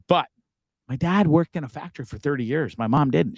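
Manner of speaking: normal talking voice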